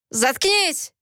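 sharp, high-pitched angry command; angry bark. A narrator's reading. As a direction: cut them off angrily. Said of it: "рявкнул Кипер". (сердитый окрик)

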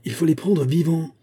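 high-pitched, urging call; excited cry. A narrator's reading. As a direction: urge the captain on like a fired-up soldier. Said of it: "crièrent quelques soldats exaltés".

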